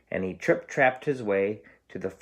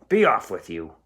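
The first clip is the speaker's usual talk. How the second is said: gruff troll dismissal; 6 dB louder than usual. Acted.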